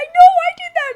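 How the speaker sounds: loud, very high squeal; hammed-up giddy yell; super excited. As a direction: shriek it back, thrilled with yourself. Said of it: "they're, like, super excited".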